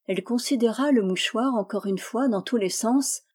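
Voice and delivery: ordinary delivery, nothing marked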